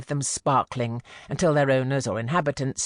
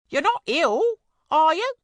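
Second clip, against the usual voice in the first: high, loud concerned call; worried cry; 4 dB louder than usual. A narrator's reading. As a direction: call up anxiously to the bedroom. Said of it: "cried Missus Harris".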